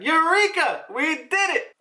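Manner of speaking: high-pitched mock triumph; triumphant exclamation. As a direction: act out a joyful breakthrough cry. Acted cry of triumph.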